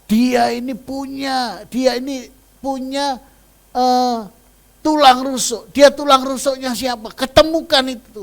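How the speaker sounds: high, loud pleading prayer; retold prayer. Mother retelling her prayer for her daughter, loud high pleading. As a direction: cry out to God to choose for her. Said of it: "Saya bilang".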